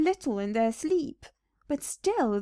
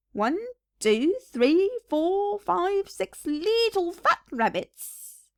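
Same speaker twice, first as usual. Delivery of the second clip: higher, slow, gloating count; McGregor repeating the count; 7 semitones higher, slower than usual. Acted. McGregor repeating his count to his wife, slow and gloating. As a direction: savour every number. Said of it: "repeated Mister McGregor".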